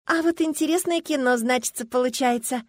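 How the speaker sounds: high-pitched affronted remark; indignant. A narrator's reading. Girl confronts the youth from behind Erion, indignant. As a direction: start in on him, put out. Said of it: "с возмущением уставилась на него".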